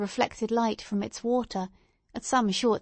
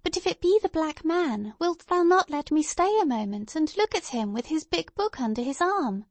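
put-on high, lilting little-girl voice; high-pitched child coaxing mother; pleading child; 7 semitones higher than usual. A narrator's reading. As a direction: beg like a small child. Narrator voices child Pearl.

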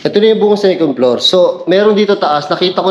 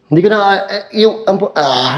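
nervous stutter, tripping over words; 5 semitones higher than usual. Real, not acted. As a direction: stammer from fear. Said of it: "nauutal ako".

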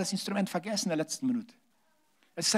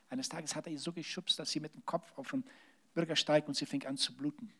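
ordinary delivery

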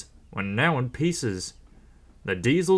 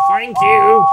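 loud, high-pitched whistling call; raised, tooting call; whistled thanks; 8 semitones higher, 13 dB louder than usual. A narrator's reading. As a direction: sing out cheerily over a train whistle. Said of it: "James whistled".

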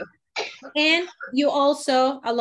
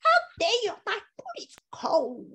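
put-on much higher, squeaky bratty child; spoiled little girl; 10 semitones higher than usual. Teacher imitating students playing a spoiled girl. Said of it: "when you're a little girl".

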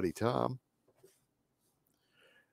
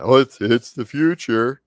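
mocking; 5 semitones higher, 9 dB louder than usual. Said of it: "We heard the same argument".

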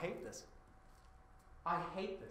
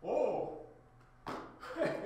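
normal talking voice

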